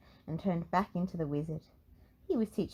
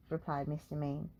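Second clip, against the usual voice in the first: ordinary delivery, nothing marked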